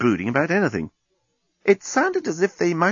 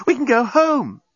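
put-on higher, eager young woman's voice; Nettie, urgent; 12 semitones higher, 3 dB louder than usual. Nettie urging Lucy to hurry home, eager. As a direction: press her to hurry home.

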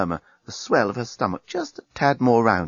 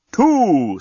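higher, louder countdown voice; talking bomb; 8 semitones higher, 7 dB louder than usual. Acted. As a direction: call the number like a bomb. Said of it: "said the bomb".

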